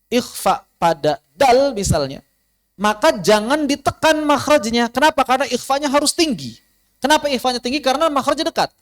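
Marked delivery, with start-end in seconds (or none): none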